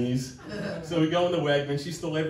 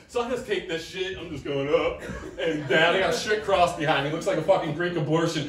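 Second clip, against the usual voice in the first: ordinary talk, nothing marked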